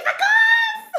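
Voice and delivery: loud, very high screech; chicken squawk. Retold or imitated. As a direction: screech it like a furious chicken, drawn out. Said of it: "Like a fucking chicken".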